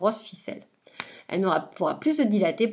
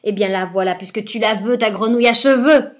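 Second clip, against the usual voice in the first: howling witch; 9 dB louder than usual. Acted. Witch howling as she gives up the frog, very loud. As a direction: scream it at him in fury. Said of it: "la sorcière se met à hurler".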